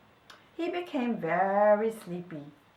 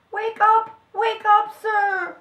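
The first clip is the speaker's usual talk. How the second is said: high, loud wake-up shout; urgent cry; 11 semitones higher, 10 dB louder, slower than usual. A narrator's reading. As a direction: helpers shouting to rouse a sleeper. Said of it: "cried the helpers to the priest".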